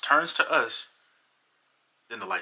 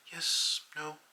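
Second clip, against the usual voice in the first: quiet; 7 semitones higher, 5 dB quieter than usual. Retold or imitated. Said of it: "She was quiet".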